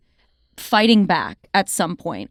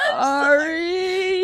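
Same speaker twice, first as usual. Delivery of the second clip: high, drawn-out fake crying; mock tearful apology; 11 semitones higher, much slower than usual. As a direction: wail a hammed-up remorseful apology. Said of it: "me getting mad and then being like".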